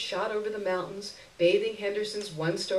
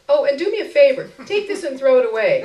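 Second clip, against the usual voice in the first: higher, louder, fast and offhand; quick breezy request; 7 semitones higher, 11 dB louder, faster than usual. A narrator's reading. Narrator to a beggar woman, rushed bright request. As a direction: toss the favor off quickly and brightly.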